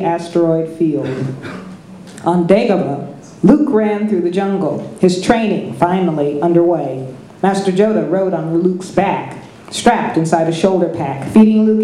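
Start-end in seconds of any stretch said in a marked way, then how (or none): none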